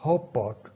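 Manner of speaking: ਹੌਪ ਪੌਟ with the first T becoming P; assimilation. Shown as a demonstration. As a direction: demonstrate ਹੌਪ ਪੌਟ, lips closed on a held P. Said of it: "ਟੀ ਪੀ 'ਚ convert ਹੋ ਗਈ".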